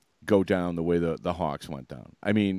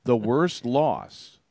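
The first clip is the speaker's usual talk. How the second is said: "The worst loss" drawn out very slowly, word by word; insistent emphasis; 3 semitones higher, much slower than usual. Real, not acted. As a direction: stretch WORST LOSS to drive the point home. Insisting in a sports argument.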